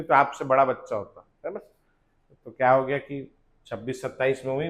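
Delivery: ordinary talk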